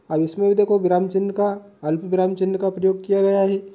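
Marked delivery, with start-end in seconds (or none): none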